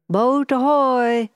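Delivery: drawn-out call across the water; hailing shout. A narrator's reading. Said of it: "shouted the captain".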